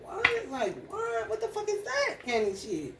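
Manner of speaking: high, incredulous mock outrage; mocking disbelief. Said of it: "They be looking like".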